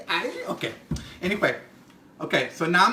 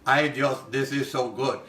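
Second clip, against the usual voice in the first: lower, plainer "Ay, Dios", anglicized; imitating friend's flat accent; 4 semitones lower than usual. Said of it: "She said it like this".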